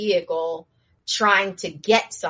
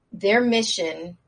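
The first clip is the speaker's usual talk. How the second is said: ordinary talk, nothing marked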